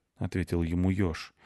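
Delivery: plain reading voice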